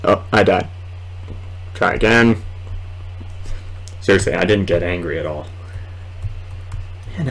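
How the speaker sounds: ordinary talk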